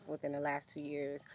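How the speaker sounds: usual speaking voice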